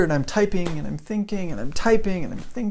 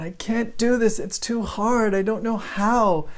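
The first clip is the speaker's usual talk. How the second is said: higher-pitched mock despair, slowing at the end; imitated tearful student; 7 semitones higher than usual. Student frustrated with essay writing, mock tearful. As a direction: imitate an overwhelmed student close to tears. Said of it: "and she's crying".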